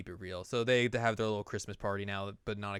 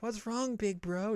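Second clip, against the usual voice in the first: put-on higher, small child's question; little girl voice; 11 semitones higher, 3 dB quieter, slower than usual. Nanako asking her big brother what's wrong: high childlike voice. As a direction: ask it like a worried little kid.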